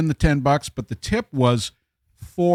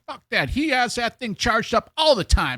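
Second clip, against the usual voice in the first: higher, indignant dismissal; mock scornful girlfriend; 9 semitones higher than usual. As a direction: scoff at the excuse. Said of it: "And she was like".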